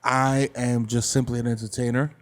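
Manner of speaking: formal put-on voice. Role-play as a suspicious neighbor's suspect.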